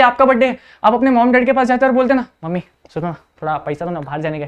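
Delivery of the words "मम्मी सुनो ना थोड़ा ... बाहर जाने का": soft, low pleading to mum; hushed, low child's request; timid request. Acted. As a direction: ask sheepishly for money, voice dropped. Child asking mother for money.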